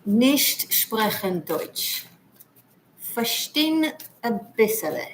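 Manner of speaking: hammed-up German accent; ridiculous German accent. Greeting a German viewer in broken German, ridiculous German accent. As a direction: play up a German accent, drawing out the phrases. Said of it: "Sorry for being ridiculous with the accent".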